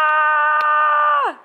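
sudden loud high "Ah!"; playful shout. Pretend. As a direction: burst out with a loud yelp. Startled play shout.

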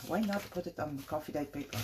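normal talking voice

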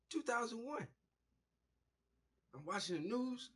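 ordinary delivery

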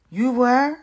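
amazed high question; surprised. A narrator's reading. With my eyes wide open.

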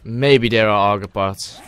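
ordinary talk

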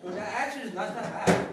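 usual speaking voice

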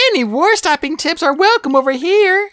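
high-pitched playful character voice; jokey high voice, mock announcement; joking. A narrator's reading. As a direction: toss it out high, as a joke. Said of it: "she joked".

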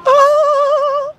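a high, sung oh of joy; delighted squeal. Real, not acted. Spots M and M peanuts, delighted.

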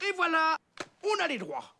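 triumphant. Acted. Triumphant after the call.

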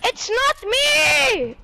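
high, long drawn-out protest; exasperated denial. Real, not acted. Accused player answering "I hear Jojo", exasperated denial. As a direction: wail the denial, stretching it out.

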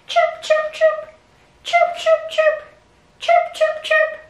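high, tiny chirps; baby bird chirping. Acted. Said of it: "Let's pretend to be baby birds".

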